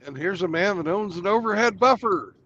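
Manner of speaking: loud, high game show welcome; announcer voice. Acted. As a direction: announce him like a host. Announcing a guest arrival.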